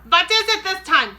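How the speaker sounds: fed up and yelling through the door; witch shouting. A narrator's reading. Grand High Witch shouting at the knock on her door. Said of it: "shouted the Grand High Witch".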